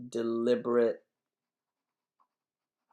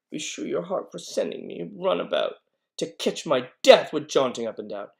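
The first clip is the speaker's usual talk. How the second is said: raised, loud reproach; scolding complaint; 4 semitones higher, 8 dB louder than usual. Acted. Nurse blaming Juliet for sending her about, loud scolding. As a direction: lay the blame on with force.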